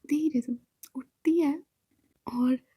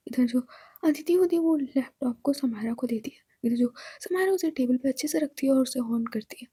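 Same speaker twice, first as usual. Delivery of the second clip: usual speaking voice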